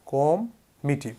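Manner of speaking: কম মিটি said slowly, split in two; teacher slowly splits committee into কম মিটি; slow split syllables. Shown as a demonstration. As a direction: break কম মিটি apart slowly as a memory trick. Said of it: "একটা জিনিস খেয়াল করুন তো".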